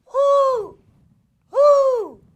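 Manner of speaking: loud, high owl hoot; long hoot, high and strong. Shown as a demonstration. Host hooting for children to guess the animal, owl hoot. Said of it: "One more time".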